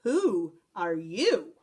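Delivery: slow gruff demand; gruff growl. A narrator's reading. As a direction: growl the question. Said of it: "growled Don Diego".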